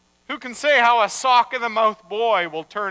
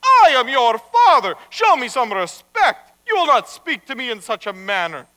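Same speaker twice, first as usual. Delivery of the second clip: louder, higher rebuke at first; stern father; 4 semitones higher, 7 dB louder than usual. Acted. Rabbi demanding respect from his marionette, stern. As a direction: scold firmly, opening loud.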